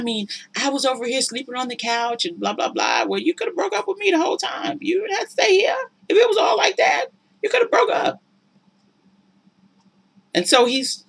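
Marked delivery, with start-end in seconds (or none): 3.1-8.2 much higher, fast, near-crying protest; Tara answering back. Retold or imitated. Tara snapping back at her cheating man, high protest. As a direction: squeal it out like she's fed up.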